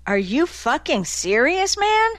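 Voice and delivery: rising to a sharp high yelp on man; a barked, incredulous question. A narrator's reading. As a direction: snap it out in disbelief. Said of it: "Mark barked".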